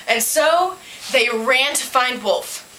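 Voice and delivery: ordinary delivery, nothing marked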